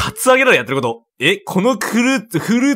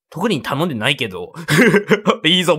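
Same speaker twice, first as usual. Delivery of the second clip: ordinary delivery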